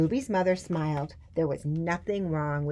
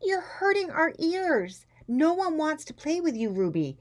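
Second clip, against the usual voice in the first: put-on high, raised-voice children's complaint; shouting; 12 semitones higher, 4 dB louder than usual. A narrator's reading. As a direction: shout down a noisy playmate. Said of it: "They all turned around and shouted".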